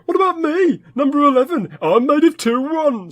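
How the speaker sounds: high-pitched plea to be counted; indignant protest. Acted. Number eleven objecting to being overlooked, high indignant protest. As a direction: pipe up high, put out at being left out.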